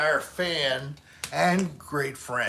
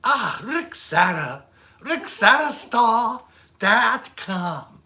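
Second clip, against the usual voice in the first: badly faked, slow Irish accent; attempted Irish accent; 6 semitones higher, 6 dB louder, slower than usual. Shown as a demonstration. Streamer plugging the channel on request, poor Irish accent. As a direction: have a clumsy go at an Irish accent. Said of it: "Try an Irish accent".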